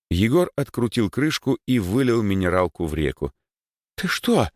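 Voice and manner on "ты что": high, appalled exclamation; horrified. Acted. Boy sees water poured away, horrified. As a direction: gasp it out in horror. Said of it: "ужаснулся Димыч".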